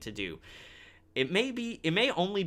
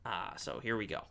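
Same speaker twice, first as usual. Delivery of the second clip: ordinary talk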